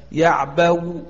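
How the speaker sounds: يعبو with a faint partial u after the waw; rawm on waw. Shown as a demonstration. Teacher demonstrates rawm, يعبو ending in faint partial u. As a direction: let a trace of u follow the w in يعبو. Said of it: "بالروم".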